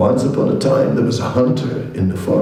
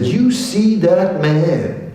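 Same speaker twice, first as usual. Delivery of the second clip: higher, slow pointed question; indignant tiger; 8 semitones higher, slower than usual. Acted. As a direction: point him out with disgust. Tiger urging bear after betrayal.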